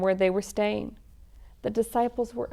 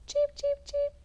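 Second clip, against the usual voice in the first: squeaky high chirping; high chick cheeps. Pretend. Playing the chicks for young listeners, high cheeping.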